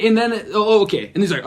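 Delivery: usual speaking voice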